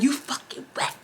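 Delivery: whispered mock scream, breathy and hushed; retold scream, whispered. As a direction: hiss the insult instead of screaming it. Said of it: "she's screaming it to him".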